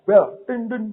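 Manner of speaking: high, loud mock honking of a horn. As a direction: toot it like a car horn. Imitates a car horn. (tiruan klakson mobil, tinggi dan keras)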